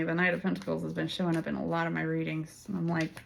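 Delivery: usual speaking voice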